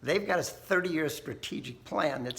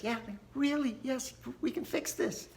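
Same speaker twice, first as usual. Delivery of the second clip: much higher, a mock-earnest "yes, we can"; insistent official; 11 semitones higher than usual. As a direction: chirp it like a cheerful bureaucrat. Official insisting on his plan.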